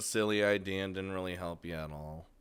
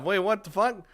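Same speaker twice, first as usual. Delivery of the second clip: sudden high startled outburst; 9 semitones higher, 4 dB louder than usual. Real, not acted. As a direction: blurt it out, caught off guard. Rin suddenly runs off.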